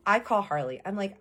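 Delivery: usual speaking voice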